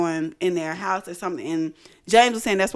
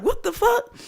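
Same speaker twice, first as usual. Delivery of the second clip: much higher shocked cry; shocked exclaim. Retold or imitated. Hearing the purse was pulled down, high shocked cry. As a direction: squeal it in disbelief. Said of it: "I'm like".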